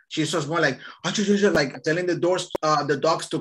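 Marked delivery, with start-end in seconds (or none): 1.0-1.5 higher-pitched mock foreign babble; mimicked fake-French gibberish; imitating gibberish. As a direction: rattle off nonsense syllables as the old lady. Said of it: "she starts talking gibberish".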